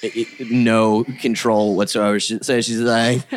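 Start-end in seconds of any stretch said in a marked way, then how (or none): none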